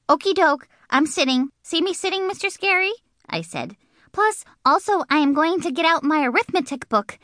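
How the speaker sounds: put-on high, eager little girl voice; chirpy Junie B. Junie B to her teacher, high and eager. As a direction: bounce it up high and eager to please.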